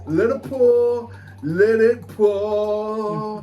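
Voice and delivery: put-on very slow, stretched Irish accent; drawn-out Irish lilt. Host watching beer pour, slow stretched Irish accent. Pouring Guinness, drawing it out.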